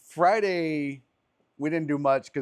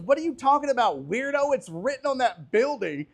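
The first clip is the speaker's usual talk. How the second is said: annoyed woman; 9 semitones higher than usual. Retold or imitated. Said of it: "She goes".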